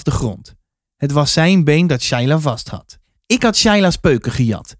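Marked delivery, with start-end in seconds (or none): none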